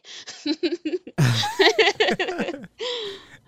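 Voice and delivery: high, loud burst of laughter; hearty real laughter. Real, not acted. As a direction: laugh out at the punchline. Laughs at the story.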